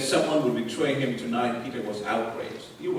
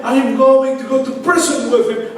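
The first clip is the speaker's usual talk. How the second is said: higher, louder, anguished; anguished remorse; 12 semitones higher, 13 dB louder than usual. Acted. Peter crying out the oath he broke, higher and louder. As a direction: cry out the broken promise in despair.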